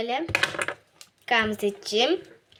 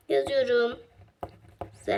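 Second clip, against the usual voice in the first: ordinary talk, nothing marked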